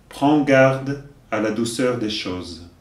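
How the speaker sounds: slow, deliberate verse delivery; poem recitation. Acted. Teacher giving verses for learners to repeat, measured recitation. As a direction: recite line by line, leaving room to repeat.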